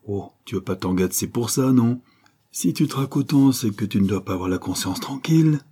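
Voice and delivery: ordinary delivery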